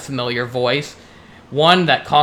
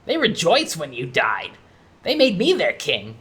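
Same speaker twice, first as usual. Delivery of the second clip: scoffing; 4 semitones higher than usual. Acted. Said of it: "Conker scoffs and says".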